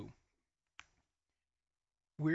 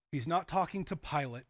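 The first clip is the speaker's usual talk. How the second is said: ordinary talk, nothing marked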